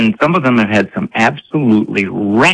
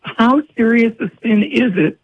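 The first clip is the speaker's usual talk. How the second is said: high, pleading exasperated mother; frazzled mom confessing; 10 semitones higher than usual. Retold or imitated. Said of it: "Go to confession".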